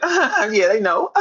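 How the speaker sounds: high, put-on laugh through the words; mock laughing reply. Mimicking rival's answer to Wack, mock laughing. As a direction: imitate him laughing it off. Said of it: "And you said".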